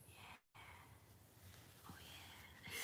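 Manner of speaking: very soft whisper; hushed murmur to a pet; whispered cooing. Real, not acted. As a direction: breathe it out, barely audible.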